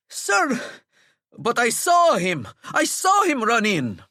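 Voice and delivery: desperate to be believed; breathless prefect protesting; high pleading protest. Acted.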